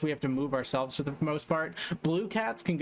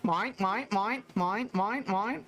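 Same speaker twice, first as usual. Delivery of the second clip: higher squawking gull chant with rising ends; seagull mine call; 7 semitones higher, slower than usual. Pretend. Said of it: "That was closer to a seal".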